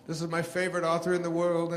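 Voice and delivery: high, loud gushing introduction; big mock-enthusiastic TV host proclamation; Oprah Winfrey. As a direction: imitate a host proudly announcing a favourite guest. Voices Oprah introducing him, gushing.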